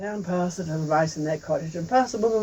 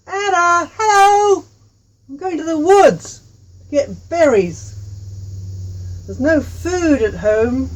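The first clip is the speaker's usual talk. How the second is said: loud high call to a friend down the road; 8 semitones higher, 9 dB louder, slower than usual. Acted. Tui calling out to Anna as she passes, loud and high. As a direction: shout it across to the neighbour's house. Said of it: "she calls out".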